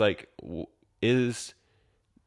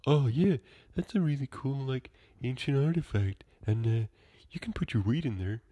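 higher, softer stoner comedian impression; Adam Sandler; 5 semitones higher, 4 dB quieter than usual. Actor's character admires an ancient artifact, high soft stoner voice. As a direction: amiable put-on voice, high and quiet. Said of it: "and he's like".

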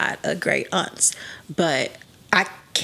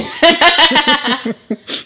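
loud high-pitched laugh; real laugh at a friend's joke; burst of laughter; 9 semitones higher, 9 dB louder than usual. Real, not acted. Reaction to great auntie bag.

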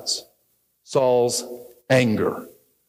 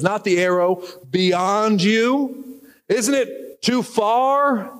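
calling out; 8 semitones higher, much slower than usual. Acted. Jonathan called after and said.

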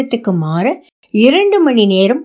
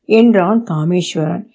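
ordinary delivery, nothing marked

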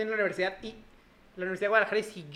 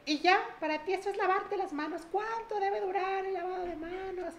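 high, strict scolding teacher voice; mock a stern teacher telling off; 11 semitones higher than usual. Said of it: "sí me gritó así como de".

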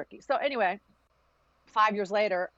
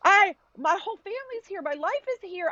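high-pitched imitated pleading; mom's protest; 11 semitones higher than usual. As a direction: imitate a mother protesting being uprooted. Acting out her mother's protest.

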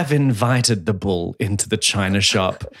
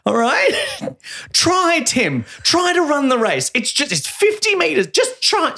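pushy coach; 12 semitones higher than usual. Acted. Coach yelling at a boy named Tim to try the race, pushy and high. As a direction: play an overbearing coach yelling from the sidelines. Mock coach pushing a kid.

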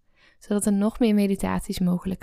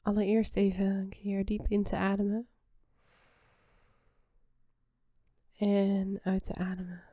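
soft spa whisper; 4 dB quieter than usual. Acted. Guides a relaxation meditation.

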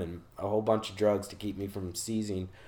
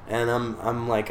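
usual speaking voice

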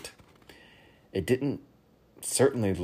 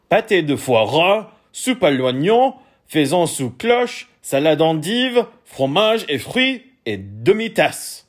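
careful; 9 semitones higher, 9 dB louder than usual. A narrator's reading. King carefully announcing his French dinner order to the waiters, loud. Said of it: "he said carefully".

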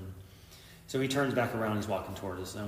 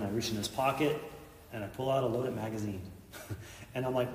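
ordinary talk, nothing marked

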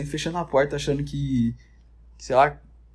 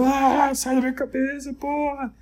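much higher, screaming at an invader of your mind; mock scream; 10 semitones higher, slower than usual. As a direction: shriek it like you're losing it. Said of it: "o bombado tá gritando".

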